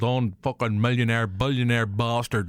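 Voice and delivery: normal talking voice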